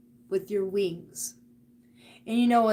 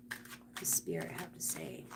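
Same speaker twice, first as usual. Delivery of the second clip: very soft, whispered question; hushed, private murmur over the cards; whispered musing; 15 dB quieter than usual. Real, not acted. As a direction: whisper it half to yourself. Murmuring while consulting the cards.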